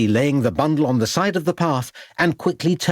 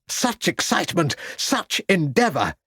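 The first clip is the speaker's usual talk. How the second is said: excited; 7 semitones higher than usual. A narrator's reading. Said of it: "said an excited Shorter".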